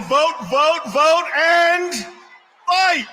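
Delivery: loud, high rally chant; rally speech. Real, not acted. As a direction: shout it up to the arena. Rally speaker chanting to crowd.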